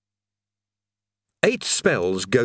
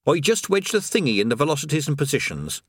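put-on higher, breezy voice of a chatty old workman; chatty creator; 4 semitones higher than usual. Creator chatting idly beside a falling man. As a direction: natter on as if all is fine.

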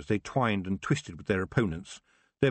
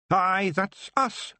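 high, slow Scots voice, puffed up with pride; a tiny chief boasting slowly; proud Feegle; 10 semitones higher, 4 dB louder, much slower than usual. Acted. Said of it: "said Rob proudly".

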